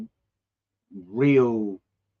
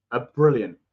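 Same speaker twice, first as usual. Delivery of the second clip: normal talking voice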